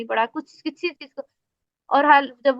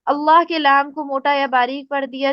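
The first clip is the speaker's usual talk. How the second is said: ordinary talk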